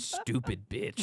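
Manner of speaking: drawn-out slow insult; slow mock insult; slow deadpan. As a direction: drag each word out flatly.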